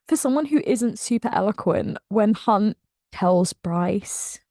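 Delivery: usual speaking voice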